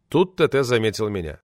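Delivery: ordinary delivery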